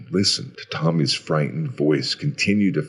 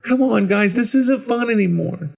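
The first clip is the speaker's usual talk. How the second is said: put-on high, loud child pleading; high, worried little voice; scared little boy; 6 dB louder than usual. A narrator's reading. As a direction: call out like a frightened kid. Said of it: "He sounded scared now".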